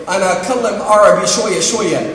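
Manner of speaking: Arabic in a put-on American accent. Traveler playing off his Arabic to a policeman, American accent. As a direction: play the clueless American attempting Arabic. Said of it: "in an American accent".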